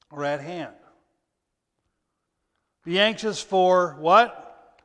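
loud, high, emphatic preacher voice; fiery preacher building the passage; preaching at full force. Acted. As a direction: drive each verse home to the congregation.

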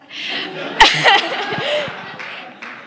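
breathy high laugh at herself; embarrassed laugh. Real, not acted. Laughs at herself about crying.

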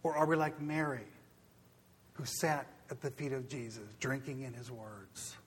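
soft, low, fading appeal; hushed preaching. Real, not acted. Preacher closing the sermon in a hushed appeal. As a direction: draw them in quietly.

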